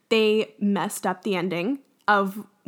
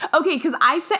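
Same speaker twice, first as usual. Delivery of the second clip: ordinary talk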